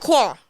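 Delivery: "Qua" said with an open ah, falling; wrong pronunciation. Shown as a demonstration. Ruling out a guest's name, "Qua" with an ah. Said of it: "I know that's not it".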